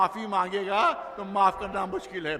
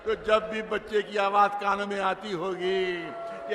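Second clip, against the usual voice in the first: usual speaking voice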